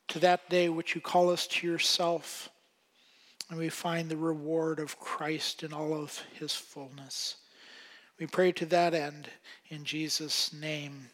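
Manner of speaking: soft, low closing prayer. Acted. Pastor closing the prayer for the church, softly and reverently. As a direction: pastor praying quietly over the congregation, hushed prayer voice.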